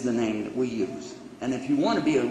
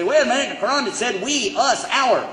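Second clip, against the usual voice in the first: objecting questioner; 9 semitones higher, 4 dB louder than usual. Retold or imitated. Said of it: "Right away somebody say".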